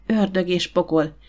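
ordinary delivery, nothing marked

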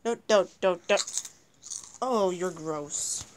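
usual speaking voice

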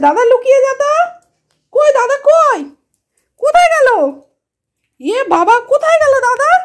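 high, sing-song talk to a pet. Real, not acted. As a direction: coax the pet in a high playful voice. Calling pets playfully. (উঁচু গলায় পোষ্যের সঙ্গে আদুরে কথা)

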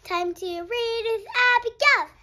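bright sing-song opening call; sing-song intro. Acted. Host opening the read-aloud for kids, sing-song. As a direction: announce the reading like a show host.

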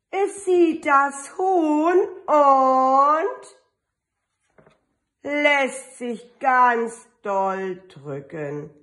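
put-on lively, drawn-out read-aloud for little kids; storybook reading. Teacher reading an Easter book to her kindergarten kids, lively and drawn out. Reads picture book to children.

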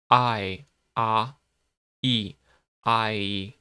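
I, ah, e glided slowly vowel to vowel; gliding diphthong. Shown as a demonstration. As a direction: stretch the diphthong to show each part. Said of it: "you travel from one vowel to the next".

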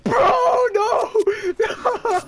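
loud high panicked yelling; panicked shout. Real, not acted. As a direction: cry out in alarm. Teammate panics, crying shout.

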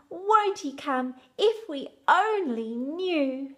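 plain reading voice

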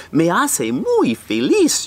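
ordinary delivery

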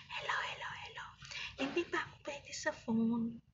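soft, barely voiced hellos; whispered. Real, not acted. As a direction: whisper to viewers, keep it hidden. Whispering greetings while doctors nearby.

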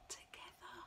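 breathy near-silent "Together."; whispered together. Acted. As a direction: whisper "Together." barely audible. Repeats ending word softly.

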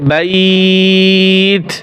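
বাইত with the আই held very long; long stretched vowel. Shown as a demonstration. Teacher demonstrating madd lin on বাইত. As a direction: stretch বাইত slowly before the ত. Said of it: "ওয়াকফ করলে কিরকম হবে".

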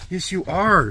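sweet talk for a good dog. Real, not acted. Cooing at the dog.

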